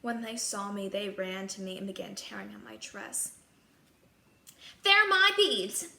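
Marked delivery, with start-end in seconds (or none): 4.8-5.9 high, loud, slow grabbing cry; shrill cry. Acted. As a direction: claim it like a spoiled sister. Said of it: "cried Gisela".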